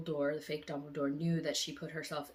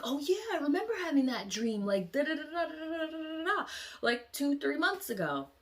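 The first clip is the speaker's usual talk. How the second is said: much higher, fast nonchalant; breezy casual recall; 10 semitones higher than usual. Retold or imitated. Vlogger imitating herself recalling a dream, high and breezy. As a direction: toss it off lightly. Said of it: "I'll be like".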